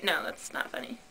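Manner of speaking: ordinary delivery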